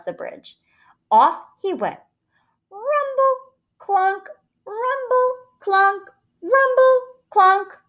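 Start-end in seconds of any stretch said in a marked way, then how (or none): 2.7-7.8 high, rhythmic imitated clomping; high, punchy onomatopoeia with gaps; hoofbeat sound effect. A narrator's reading. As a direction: chant it like hooves on a bridge. Narrator imitates hooves on bridge.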